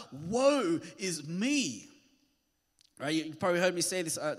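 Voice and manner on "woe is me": slow, drawn-out woe; Isaiah's lament. Acted. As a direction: stretch it out like a lament. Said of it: "his first words are".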